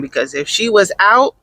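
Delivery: ordinary delivery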